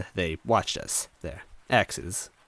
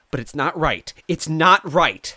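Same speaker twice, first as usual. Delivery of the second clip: high, loud, rising to a shout; escalating tearful yell; furious vow; 12 semitones higher, 10 dB louder than usual. Acted. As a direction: swear it through tears and rage. Toadette vows, fists curled.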